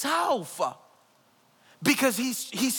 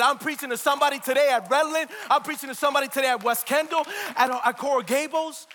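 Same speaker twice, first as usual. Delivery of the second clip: normal talking voice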